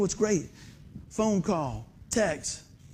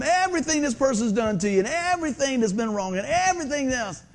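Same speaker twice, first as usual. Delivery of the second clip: "everything" stretched out high each time; stress on everything; 5 semitones higher than usual. Acted. Believer listing grievances to God, leaning hard and long on "everything". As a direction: swell and draw out every "everything".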